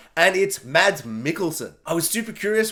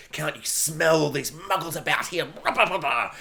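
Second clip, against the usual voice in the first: quiet malice; 4 semitones lower, 4 dB quieter than usual. Retold or imitated. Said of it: "that malice creeps in".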